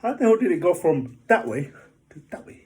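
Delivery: can't believe where it went; disbelief. Retold or imitated. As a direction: golfer amazed at the ball's path. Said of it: "I was like".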